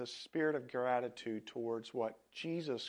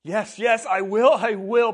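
much higher and much louder, excited yeses; eager promise; 9 semitones higher, 16 dB louder than usual. A narrator's reading. Healed leper eagerly promises Jesus, very loud excited. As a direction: hurry the promise out. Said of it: "promised the man".

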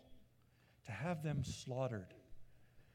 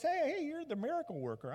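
high, mocking eager greeting; eager Herod; 11 semitones higher than usual. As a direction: taunt the miracle worker. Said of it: "Herod goes".

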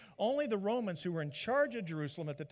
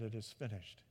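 low, soft final breath; Jesus' last words on the cross, low and soft; dying words; 6 semitones lower, 8 dB quieter than usual. Retold or imitated. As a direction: let it go quietly.

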